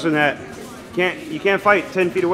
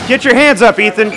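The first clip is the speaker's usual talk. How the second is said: shouting; 5 semitones higher, 7 dB louder than usual. Real, not acted. Coach yelling at sparring kid.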